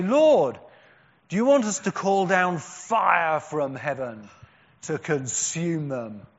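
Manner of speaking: loud, eager request for fire from heaven; zealous loud plea. Acted. Preacher voicing James and John, eager loud plea. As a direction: call it out like an indignant disciple. Disciples asking to burn village.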